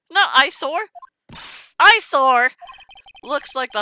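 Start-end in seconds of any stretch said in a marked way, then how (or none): 0.1-0.9 high, rising query on EYESORE; baffled question. Real, not acted. Reader querying the word eyesore, baffled rising tone. Queries odd word just read. 1.8-2.5 loud, falling exclamation of EYESORE; incredulous outburst. Real, not acted. Reader repeating the odd word eyesore, loud incredulous blurt.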